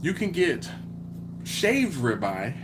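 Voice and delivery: usual speaking voice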